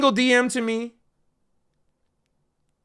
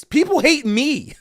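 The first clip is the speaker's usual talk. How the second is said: laughing; 7 semitones higher, slower than usual. Real, not acted. Laughing while saying it.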